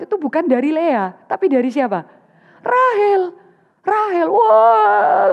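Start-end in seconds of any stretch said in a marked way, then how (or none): 2.6-5.3 crying out a name. Acted. Names the one who wounds Jacob.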